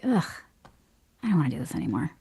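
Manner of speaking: low, soft, worn-out resignation; imitated weary groan of giving up; fed up. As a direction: sigh it out, sick of it all. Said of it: "I just got sick of doing it".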